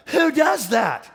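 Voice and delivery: usual speaking voice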